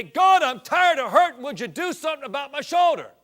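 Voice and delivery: a cop shouting his prayer to heaven; urgent shouted plea to God; passionate prayer shout. Retold or imitated. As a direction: cry "God" out loud and high, pleading. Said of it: "with the same feeling, the same passion".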